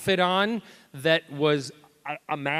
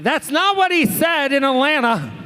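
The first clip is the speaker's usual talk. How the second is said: higher, louder mock accusation; mock fan complaint; 10 semitones higher, 3 dB louder than usual. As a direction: tease like a fan catching him out. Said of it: "I'll be like".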